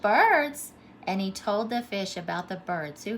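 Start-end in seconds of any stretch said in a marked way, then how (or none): 0.0-0.7 high, loud astonished echo; surprised. A narrator's reading. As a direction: gasp it back in wonder. Fish surprised by the word.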